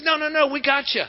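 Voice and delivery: high, cheerful insistence; lightly voiced friends; insistent friends. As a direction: reassure him brightly.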